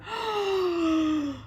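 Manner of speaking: long, drawn-out intake before a sneeze; big acted sneeze wind-up; pre-sneeze gasp. Bear building up to sneeze.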